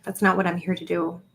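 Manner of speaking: usual speaking voice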